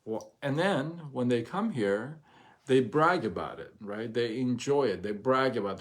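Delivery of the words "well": ordinary talk, nothing marked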